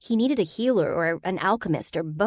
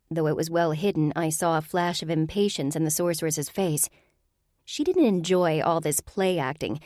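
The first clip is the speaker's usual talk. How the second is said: usual speaking voice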